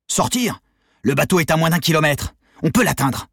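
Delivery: high, forceful arguing; urgent insistence. A narrator's reading. Leader arguing for boat escape, urgent insistence. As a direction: push the escape plan hard.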